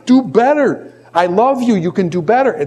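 mock scream of tough love; imitated scream. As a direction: urge them on in a high, insistent voice. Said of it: "she's screaming at me".

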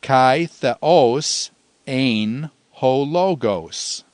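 measured Greek, word by word with gaps; slow recitation. Shown as a demonstration. Teacher giving students the third Greek line, slowly recited. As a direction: draw each word out steadily for learners. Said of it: "the third line".

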